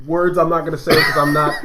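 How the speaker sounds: ordinary talk, nothing marked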